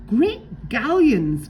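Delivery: high, loud, slow astonished gasp; stunned, drag it out. A narrator's reading. Pegleg discovers Redbeard is a woman, gasping loud and high. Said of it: "gasped Pegleg".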